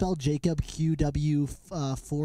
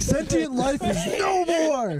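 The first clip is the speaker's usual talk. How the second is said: dramatic villain quote; 11 semitones higher, slower than usual. Retold or imitated. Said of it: "He's like".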